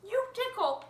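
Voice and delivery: a high, loud ticklish cry; tickled bird voice. Acted. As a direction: squeal that it tickles. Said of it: "he said".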